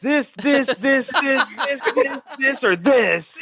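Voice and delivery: mock stoned viewer. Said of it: "being like".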